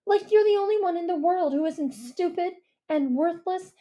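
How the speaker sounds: Mia's bitter accusation; scornful girl. A narrator's reading. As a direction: list his sins with contempt. Mia voiced by narrator.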